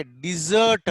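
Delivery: "డిజర్ట్" as di-ZURT, second syllable drawn out; pronunciation model. Shown as a demonstration. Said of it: "దీన్ని ఎలా పలకాలంటే". ("డిజర్ట్" రెండో అక్షరం సాగదీసి పలకడం)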